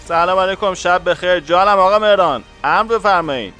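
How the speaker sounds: thin telephone-line greeting; voice over the phone. A narrator's reading. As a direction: answer a superior's call courteously. Colleague answering a phone call. (صدای پشت تلفن، مؤدبانه)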